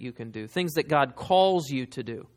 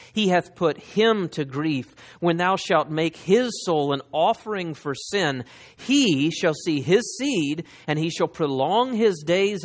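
slow, swelling, louder scripture reading; preaching scripture; 4 dB louder, slower than usual. Acted. Preacher proclaiming Isaiah fifty-three, slow and louder. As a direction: build the verse like a proclamation. Reads verse ten aloud.

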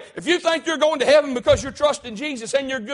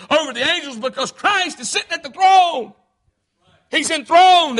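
loud, higher cry of praise; preacher's shout; 5 semitones higher, 4 dB louder than usual. Acted. Pastor proclaiming Christ enthroned, shouting. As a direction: lift it up in triumph.

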